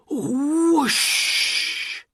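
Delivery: loud, high rising whoosh of magic smoke. A narrator's reading. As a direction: burst out a big whoosh like smoke exploding. Magic smoke bursts from ring.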